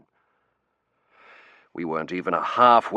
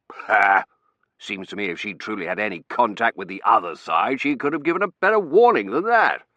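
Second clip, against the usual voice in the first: scoffing; 7 dB louder than usual. Acted. Colonel scoffs at the medium.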